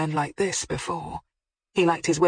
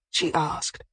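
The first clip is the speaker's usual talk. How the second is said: plain reading voice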